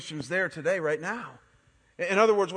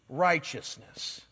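drawn out slowly; 4 semitones lower, much slower than usual. Real, not acted. Drawn out punch word.

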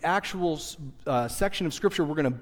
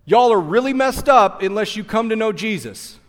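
louder, higher preacher call; mock preaching shout; 4 semitones higher, 6 dB louder than usual. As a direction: belt it to the stadium. Pastor preaching in stadium.

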